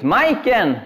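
a refugee calling out to the woman from his dream. Retold or imitated. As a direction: shout her name across the street. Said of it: "Han ropar ut".

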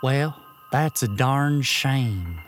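put-on slow, gentle children's TV host voice; Mister Rogers. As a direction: talk soothingly like a kids' show host. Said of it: "I'm trying to talk like Mister Rogers".

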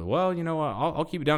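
sheepish Terry. Retold or imitated. Terry answering the ranger, mock sheepish retreat. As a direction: back down like a scolded son. Terry voiced, caught speeding.

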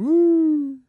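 loud high cheer; excited woo. Real, not acted. Co-host cheering the WrestleMania trip, loud whoop.

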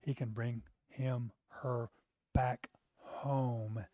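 ordinary talk

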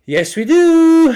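very high, loud, drawn-out reply; mock over-the-top shop assistant; exasperated pharmacy worker. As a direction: stretch it out in a hammed-up shrieky answer. Pharmacy worker's answer, exaggerated.

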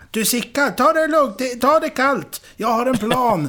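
high, loud, emphatic reassurance; calling out in character. A narrator's reading. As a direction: call to a trapped mate to calm down. Vanheden calls to shouting Sickan.

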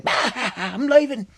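high, loud cackling taunt; drawn-out, gleeful high voice; taunting cake. A narrator's reading. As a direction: cackle and announce your escape slowly. Cake taunts Astro while escaping.

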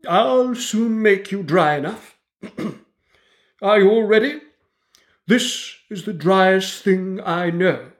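high, slow, self-important mouse; pompous mouse lecturer. A narrator's reading. Mouse lectures the dripping animals, high and pompous. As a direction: lecture the wet animals grandly, like a tiny professor.

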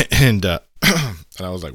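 ordinary talk, nothing marked

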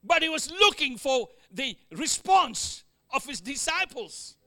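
ordinary delivery

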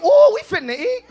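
put-on high, loud, laughing kid voice; excited kids imitation. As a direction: squeal like a happy kid. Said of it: "my daughters now are saying".

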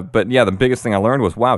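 ordinary talk, nothing marked

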